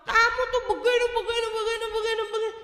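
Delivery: mother mocking her own endless scolding of the kids; nagging mother.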